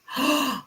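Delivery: sharp, high-pitched gasp; shocked gasp. Real, not acted. Reader reacting to his rude reply, shocked gasp. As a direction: suck in a high, scandalized breath.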